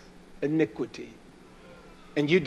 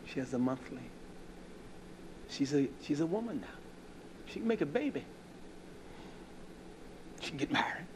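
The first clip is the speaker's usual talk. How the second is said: hushed retold counsel; 10 dB quieter than usual. Preacher recalls advising Brother Lindsay, hushed. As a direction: lean in and counsel him quietly.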